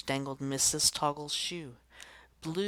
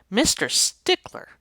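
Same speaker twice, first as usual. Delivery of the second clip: interrupting call; 7 semitones higher, 10 dB louder than usual. Acted. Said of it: "interrupted Missus Toggle".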